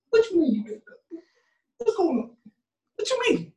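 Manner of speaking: high, incredulous mock questions; hammed-up disbelief at the altar; high mock what-you-mean; mock shocked groom. Groom confronting his bride.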